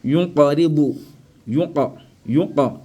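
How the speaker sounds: "Yunqaribu" with a released q, qa echoed; qalqala. Shown as a demonstration. Teacher demonstrates avec qalqala, echoed q. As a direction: bounce on the q in "Yunqa". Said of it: "Ici, avec qalqala".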